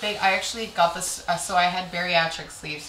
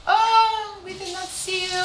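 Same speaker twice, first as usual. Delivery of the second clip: very high, fake-sweet sing-out; sneaky passive-aggressive; 13 semitones higher, 3 dB louder, slower than usual. Coworker snubbing a colleague about the party, sneaky fake-sweet. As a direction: sing it out sweetly as a veiled dig. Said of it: "It was sne-, it wasn't, like, outward fights".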